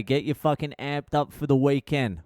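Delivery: ordinary talk, nothing marked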